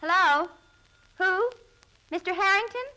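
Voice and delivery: ordinary delivery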